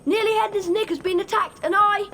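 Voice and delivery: insistent, worked-up objection; urgent protest. Acted. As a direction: protest urgently, loud and high.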